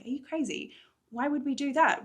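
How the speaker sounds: incredulous. Acted. Morgan scoffing at climbing plan.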